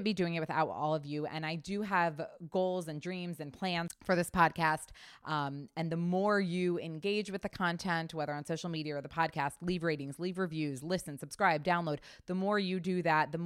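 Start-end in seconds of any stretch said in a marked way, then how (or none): none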